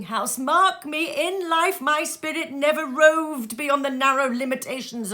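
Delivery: high, loud despairing cry; anguished ghost. Acted. Ghost to Scrooge, high loud anguish. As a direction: cry out that you cannot rest.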